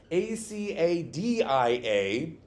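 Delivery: slow letter by letter; spelling the name letter by letter; spelled out. Shown as a demonstration. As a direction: spell out each letter slowly. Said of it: "you spelled it".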